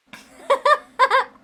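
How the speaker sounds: high burst of loud laughter. Real, not acted. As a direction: crack up loudly.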